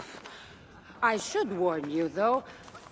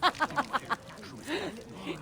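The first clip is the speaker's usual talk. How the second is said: amused chuckle; 4 semitones higher, 5 dB quieter than usual. Acted. Amused by the Malaka joke.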